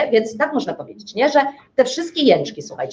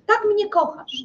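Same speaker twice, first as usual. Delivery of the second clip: wyżej, ciszej, z udawanym żalem; rzuć to z gorzkim przekąsem; ironiczny wyrzut; 9 semitones higher, 3 dB quieter than usual. Acted. Żona z ironią do męża. (żona powtarza wyrzut mężowi, wyżej i ciszej)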